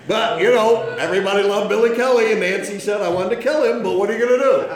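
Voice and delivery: loud, high, amused telling; real laughter lifting the voice; laughing through it. Real, not acted. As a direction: talk over your own laughter. Still laughing after the punchline.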